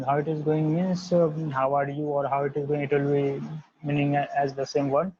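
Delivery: usual speaking voice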